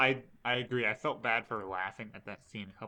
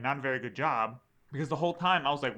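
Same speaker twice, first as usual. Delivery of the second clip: usual speaking voice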